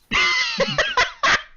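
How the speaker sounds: high shrieking laugh. Real, not acted. Reader cracking up at his own insult.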